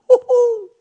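high, loud whoop; excited whoop. Real, not acted. Preacher thrilled by God's promise, excited whoop. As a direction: let out a high cheer of delight.

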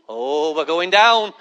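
long, low drawn-out dread; dawning dread. Acted. Passenger in turbulence, low slow dread. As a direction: let the words stretch out slowly and low.

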